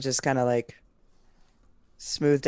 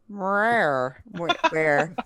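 a hint of a cat voice; 3 semitones higher, 3 dB louder, much slower than usual. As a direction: try out a cat voice, meowing the word. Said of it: "I could do a cat voice".

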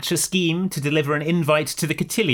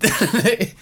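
short high-pitched laugh; a quick burst of giggles; 8 semitones higher than usual. Real, not acted. Laughing at the picture joke.

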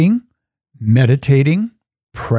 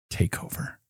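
whispered prayer; 2 semitones lower, 15 dB quieter than usual. Shown as a demonstration. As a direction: whisper it, no voice at all. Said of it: "encouraging and inviting just about those words".

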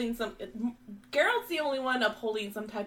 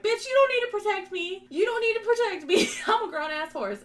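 high, loud mock outrage; loud comic telling-off, pitched way up; sassy indignant horse; 10 semitones higher, 5 dB louder than usual. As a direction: protest as an insulted horse, hammed-up. Voices Roach indignantly rebuking Geralt.

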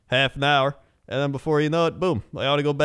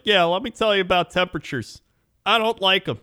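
high, mock chipper rambling; mock newbie; 7 semitones higher than usual. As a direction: play a clueless newcomer filling dead air. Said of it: "I'm just like".

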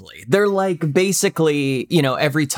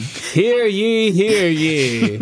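town crier; 6 semitones higher, much slower than usual. Pretend. Mock royal greeting.

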